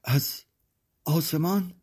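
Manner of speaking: put-on high, frightened child asking; disbelieving, scared little voice; terrified question. A narrator's reading. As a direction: panic rising at the word آسمان. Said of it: "کودکم وحشت زده تر شد".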